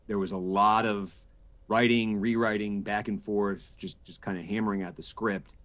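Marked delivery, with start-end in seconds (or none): none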